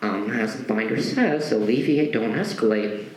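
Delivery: low, measured, fading teacher voice; prim teacher voice. Retold or imitated. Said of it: "And she says".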